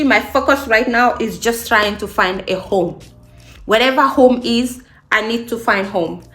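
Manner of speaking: normal talking voice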